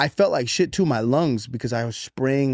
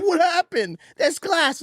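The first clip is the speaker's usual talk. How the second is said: put-on high childlike voice, much higher; imitating his kid. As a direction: mimic a small kid pointing out glass. Voicing his kid in story.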